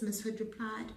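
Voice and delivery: usual speaking voice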